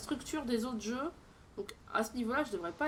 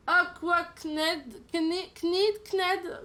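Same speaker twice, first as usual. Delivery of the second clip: bad English accent; 5 semitones higher, 4 dB louder, much slower than usual. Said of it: "mon accent anglais est pourri".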